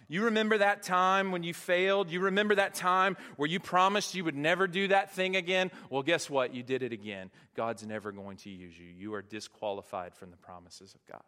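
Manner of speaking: mocking accuser. Preacher voicing the enemy accusing the congregation, taunting. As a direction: needle them about their failures. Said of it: "That's the voice of the enemy".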